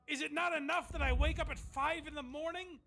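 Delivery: mock him as a put-upon martyr; mock martyr whine. Host mocking Aaron's complaint about waking early, hammed-up whine. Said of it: "This idea that Aaron is like".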